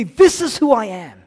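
loud, high-pitched acted confession; outpoured confession. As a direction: cry it out as if confessing to God.

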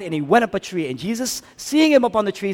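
high, loud preaching climb; preaching at full force. Acted. Pastor to congregation, full-force preaching.

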